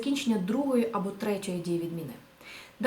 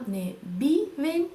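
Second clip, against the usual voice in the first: wrong form, slow; 3 semitones higher, much slower than usual. Shown as a demonstration. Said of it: "Да?".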